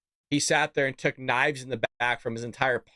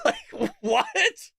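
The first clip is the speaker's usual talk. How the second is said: laughing disbelief; 10 semitones higher than usual. Real, not acted.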